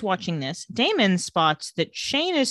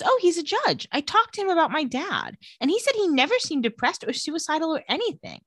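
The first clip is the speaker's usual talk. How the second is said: put-on high-pitched quote of a young woman; lightly voiced April, pitched up; April's voice; 7 semitones higher than usual. Recapping April talking about her dad, lightly imitated in a high voice. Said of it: "And she says".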